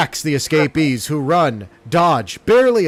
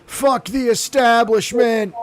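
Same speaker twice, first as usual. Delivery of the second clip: hammed-up rebel punk, pitched higher; snarling punk; 8 semitones higher than usual. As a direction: sneer it like a teenage punk. Mock rebel outburst.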